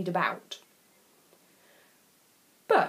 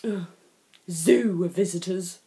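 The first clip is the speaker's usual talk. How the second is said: muttered. A narrator's reading. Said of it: "muttered Daddy Lion".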